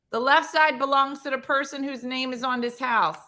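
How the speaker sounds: ordinary talk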